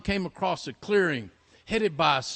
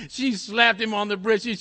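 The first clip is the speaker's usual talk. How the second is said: usual speaking voice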